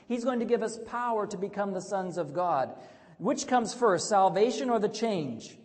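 rousing pulpit delivery; full-force preaching. Acted. Preaching the sermon.

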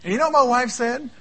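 loud laughing "yeah". Real, not acted. Preacher laughing with the congregation, loud real laugh. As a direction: burst out laughing at it. Laughs at his own story.

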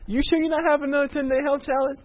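high, pleading question; hopeful plea. Retold or imitated. Neighbor begs the couple for another challenge, high and hopeful.

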